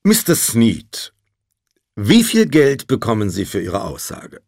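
loud, forceful lawyer's challenge. A narrator's reading. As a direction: ask it loudly, for all to hear. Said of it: "fragte dann mit lauter Stimme".

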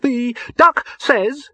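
high, slow, faltering bunny; high rabbit voice drifting off; wavering rabbit. Acted. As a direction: lose the thread as a high rabbit. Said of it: "he wavered".

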